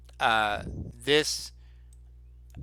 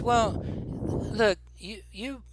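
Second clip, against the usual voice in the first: put-on higher-pitched, hesitant grown-up fobbing off a child; motherly woman shopkeeper; 7 semitones higher than usual. Shopkeeper tells a child to leave it to adults, motherly. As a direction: gently wave the kid away, pitched up. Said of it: "and she says".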